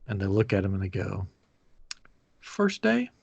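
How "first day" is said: a knowing, incredulous check; amused disbelief. Retold or imitated. Cast member asking the lost new hire, incredulous. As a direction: question pitched much higher.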